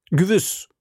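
a mildly assured husband; with certainty. Acted. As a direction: state it without doubt. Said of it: "versetzte Bonacieux mit Bestimmtheit".

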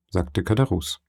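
ordinary delivery, nothing marked

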